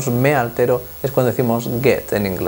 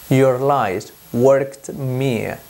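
slow model reading; much slower than usual. Shown as a demonstration. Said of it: "teacher models the English phrase".

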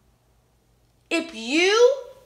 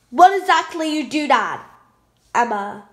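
ordinary talk

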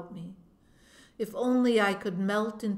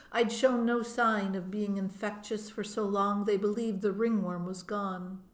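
usual speaking voice

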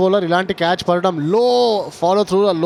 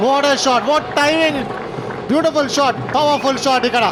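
excited cricket commentary; 7 semitones higher than usual. Real, not acted. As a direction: gush over the shot. Big shot praised live. (ఉత్సాహంగా ప్రశంస)

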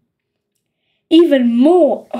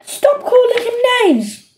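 much higher scolding mum voice; mother scolding; 10 semitones higher than usual. A narrator's reading. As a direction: tell them off from another room.

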